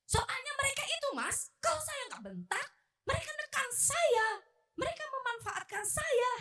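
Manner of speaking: high-pitched complaining to Mas; nagging self-justification. Acted. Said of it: "Tapi saya masih bawel ya". (mengomel membela diri dengan suara tinggi)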